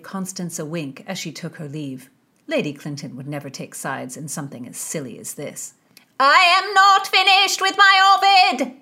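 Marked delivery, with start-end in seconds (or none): 6.2-8.7 high, shouted protest; loud exclamation. A narrator's reading. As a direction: bellow it in outrage. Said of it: "Sir Francis exclaimed".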